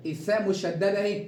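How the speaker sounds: ordinary delivery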